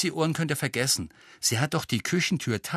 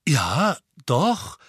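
higher, hesitant realisation; dawning realisation; 5 semitones higher, 5 dB louder than usual. A narrator's reading. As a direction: let it dawn on you word by word. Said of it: "als ginge ihm langsam ein Licht auf". (langsam begreifend)

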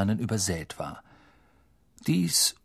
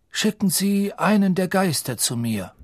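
put-on higher, grave old headmaster; Dumbledore voice; 8 semitones higher than usual. Dumbledore gives an order.

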